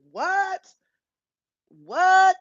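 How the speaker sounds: high, sharp disbelieving what; shocked. Real, not acted. Startled streamer reacting to suddenly being live, high and incredulous. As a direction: yelp it out in real disbelief.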